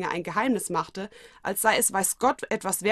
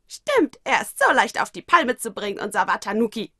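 teasing little creature; 3 semitones higher, 6 dB louder than usual. Acted. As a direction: needle him gleefully. Mokona character voice.

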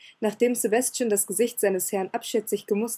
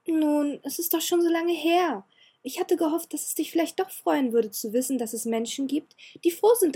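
put-on higher girlish voice, earnest and begging; pleading girl; 4 semitones higher than usual. Elizabeth begging the cold Ciel to accept her gift, pleading. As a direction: plead with him to be glad. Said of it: "Das Lächeln im Gesicht des Mädchens machte einem Flehen Platz".

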